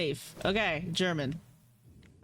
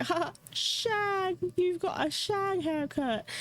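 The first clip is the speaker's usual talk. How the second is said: high, slow British caricature; hammed-up British taunt; mock British voice; 12 semitones higher, slower than usual. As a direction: stretch it out in a squeaky-high British impression. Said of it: "That voice echoes in my brain".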